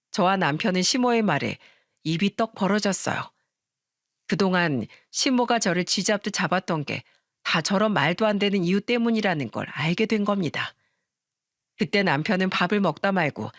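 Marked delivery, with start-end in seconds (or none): none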